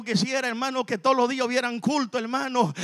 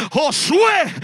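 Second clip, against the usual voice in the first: loud, raised call of a name; strong voice; 5 semitones higher, 5 dB louder than usual. Retold or imitated. God's voice calls the sleeping man by name, strong voice. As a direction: imitate a powerful voice calling into the room. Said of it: "se mete una voz fuerte".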